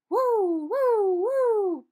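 high, loud hooting woo sounds; loud high elephant hooting; loud high woo blowing. A narrator's reading. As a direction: blast out big elephant woos. Elephant trying to blow smoke.

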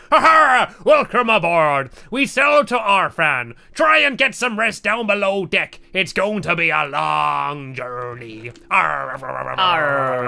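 pirate voice. Acted. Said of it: "It says".

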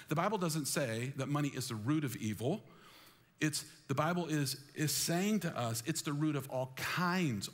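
normal talking voice

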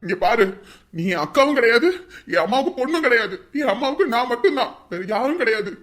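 loud furious tirade; angry shouting. Acted. Son disowning his sister, loud angry tirade. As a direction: rage at her and order her out.

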